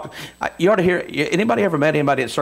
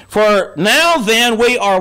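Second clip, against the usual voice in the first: preaching cadence; 7 semitones higher, 5 dB louder, much slower than usual. Acted. Preacher stretches the verse.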